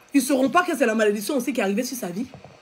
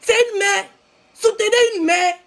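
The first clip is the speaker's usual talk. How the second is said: higher, mock pleading of strangers; mocking gossiping public; 8 semitones higher than usual. Mimicking strangers on Facebook, mock pity. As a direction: parody the crowd's fake pity. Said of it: "ils vont dire".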